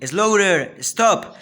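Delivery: high, loud playful command; pretend captain's shout. A narrator's reading. Boy playing captain shouts for the boat to stop. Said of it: "he shouted".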